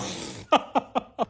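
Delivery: short amused laugh. Real, not acted. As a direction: break into a laugh. Laughing at own joke.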